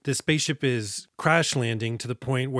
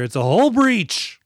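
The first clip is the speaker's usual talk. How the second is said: loud, drawn-out dramatic announcement; mock alarm; 8 semitones higher, 6 dB louder, much slower than usual. Host announcing the action scene to co-host, mock alarm. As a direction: call out the disaster, high and slow.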